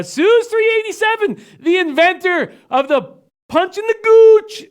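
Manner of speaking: big drawn-out announcer shout; too loud. Real, not acted. Host hailing a viewer joining the chat, way too loud. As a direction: belt it out high, stretching the words. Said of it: "Now you're too loud".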